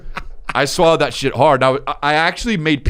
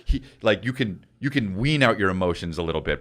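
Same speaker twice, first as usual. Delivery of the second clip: ordinary talk, nothing marked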